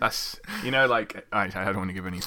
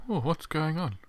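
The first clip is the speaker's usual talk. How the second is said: mock doctor; 6 semitones higher, 3 dB quieter than usual. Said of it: "Now the doc's like".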